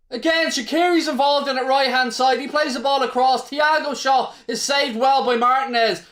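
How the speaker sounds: excited replay. Real, not acted.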